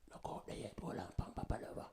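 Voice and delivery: hushed, whispered holding back; quiet inner voice. Retold or imitated. The inner voice tells him to wait, whispered. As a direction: whisper it like a voice telling you to hold on. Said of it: "listen to that little quiet voice there".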